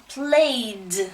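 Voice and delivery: "played" drawn out on the A vowel; slow "played" with voiced d ending; long A sound. Shown as a demonstration. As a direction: stretch the A in "played", then sound the d. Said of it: "it sounds like an A".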